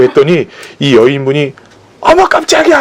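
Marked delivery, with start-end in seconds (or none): 2.0-2.8 sudden high yelp of fright; startled cry. Retold or imitated. Woman startled at night by a stranger, high gasping cry. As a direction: jump and squeal as if grabbed from behind. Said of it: "소리를 지르면서".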